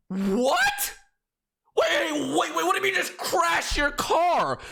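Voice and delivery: high, loud incredulous outburst; shocked disbelief. Retold or imitated. Man learns she crashed the car, loud disbelief. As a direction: cry out, stretching the words in shock.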